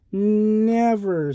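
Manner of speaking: high, loud, drawn-out never; defiant never. A narrator's reading. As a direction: stretch never out stubbornly. Tina defiantly insists.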